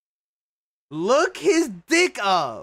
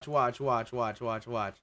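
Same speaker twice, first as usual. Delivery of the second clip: softer, low-key urging; hushed watch; 13 semitones lower, 10 dB quieter than usual. Real, not acted. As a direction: quietly tell chat to watch. Waiting for the bulge to appear.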